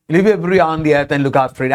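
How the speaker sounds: rattled off quickly, patapatas speed; fast natural pace. Shown as a demonstration. Rattling off the verse quickly, like street talk to learners. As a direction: rush it out in quick native flow. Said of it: "I should not speak like this patapatas".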